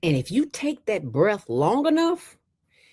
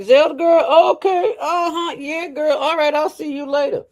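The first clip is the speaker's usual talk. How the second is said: high, mock-polite voice backing away from bad breath; mock polite brushoff; 11 semitones higher, 5 dB louder than usual. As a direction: play the friend edging away politely. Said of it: "they'll be like".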